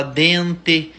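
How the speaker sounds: "dente" said with a clear closed final "e" and a hard "t", not "dentchi"; final e kept. Shown as a demonstration. Copying the neighbouring town's speech, slow "dente" with closed final e. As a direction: slowly say "dente", ending on a full "e". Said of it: "lá o povo fala".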